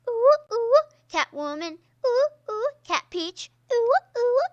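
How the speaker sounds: high, loud ooh-ooh hoots; cheering hoots. Real, not acted. Hooting for Cat Peach's win, high loud cheering. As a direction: hoot for the champ.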